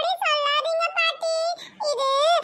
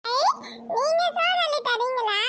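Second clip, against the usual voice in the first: ordinary talk, nothing marked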